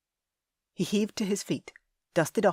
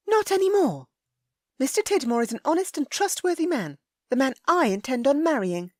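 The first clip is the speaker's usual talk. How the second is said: high, loud proud lady; Ellie, haughty; 9 semitones higher, 6 dB louder than usual. A narrator's reading. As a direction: declare it with her chin up.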